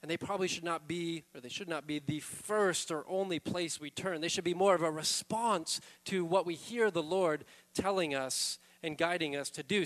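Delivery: ordinary talk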